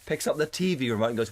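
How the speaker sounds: ordinary talk, nothing marked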